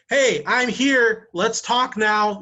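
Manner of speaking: loud, high and very slow, every word hammered; a hammed-up pushy marketer shouting at a customer; mock yelling. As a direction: bellow it like an email in all caps. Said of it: "Why are you yelling at people?".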